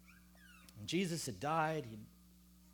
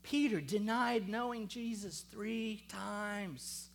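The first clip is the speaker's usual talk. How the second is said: ordinary delivery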